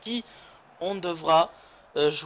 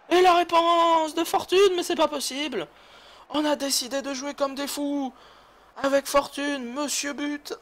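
excited commentator; 9 semitones higher than usual. Real, not acted. Hyped commentator goal call.

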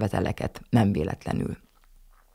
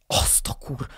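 cut-off curse; 5 dB quieter, slower than usual. A narrator's reading. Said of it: "kiáltotta a nagymama".

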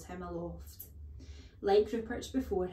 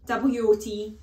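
quick answer; 2 semitones higher, 11 dB louder than usual. Acted. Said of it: "answering too quickly".

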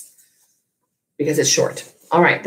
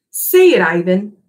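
much higher and louder insistent order; commanding; 5 semitones higher, 6 dB louder than usual. Acted. Bob insisting loudly that Ivan say his name. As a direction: shout the order so he can't refuse. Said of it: "Bob commands".

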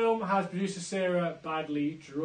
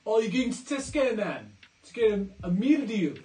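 loud, higher-pitched mock South African; South African accent; 4 semitones higher, 5 dB louder than usual. Asking a mate about a Tesco trip, in South African accent. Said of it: "South African".

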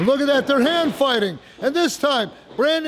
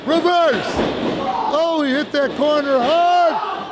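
high, urgent play-by-play burst; excited call; 4 semitones higher than usual. Real, not acted. Commentator calling the reversal into the corner, excited. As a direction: shout the reversal as it happens.

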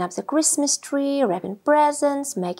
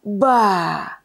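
a short, lower, grumpy huff; huffed bah; 4 semitones lower than usual. A narrator's reading. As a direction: huff it out in irritation. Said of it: "huffed Grumpy Badger".

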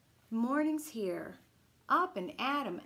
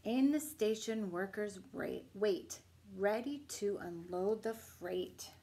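gentle bedtime story reading; reading to children; slower than usual. Acted. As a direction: bedtime picture book read-aloud. Reading picture book aloud.

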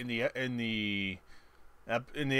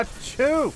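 fake sneeze; 12 semitones higher than usual. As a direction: ham up a big sneeze. Exaggerated fake sneeze in commentary.

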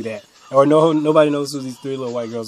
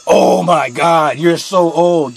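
slow, mocking drawn-out sarcasm; condescending; 2 semitones higher, 7 dB louder, much slower than usual. Cashier mocking a thirteen-year-old customer, condescending. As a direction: talk down to her, slowly. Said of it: "I was being condescending".

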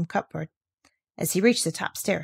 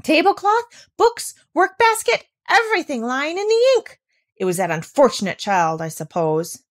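appalled governess; 5 semitones higher, 3 dB louder than usual. A narrator's reading. Rottenmeier scolding over spilled ink.

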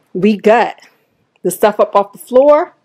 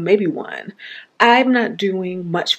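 ordinary delivery

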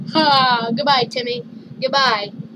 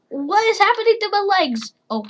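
ordinary talk, nothing marked

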